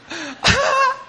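quick laugh, high-pitched; short high laugh. Real, not acted. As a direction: laugh out loud at the joke. Laughs at his own joke.

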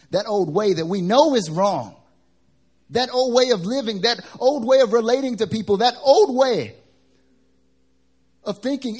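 high, loud preaching build. Acted. Preacher urging the congregation to leave old ways, high and loud. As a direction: preach it out with full force.

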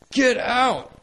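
re-enacted furious yell, high and loud; angry shout. Caretaker ordering laughing kids out, angry shout. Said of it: "Jam's getting angry".

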